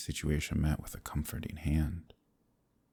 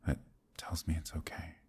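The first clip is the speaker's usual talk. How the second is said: hushed reassuring whisper; 6 dB quieter than usual. Acted. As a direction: let it fall to a whisper. Tender confession to listener.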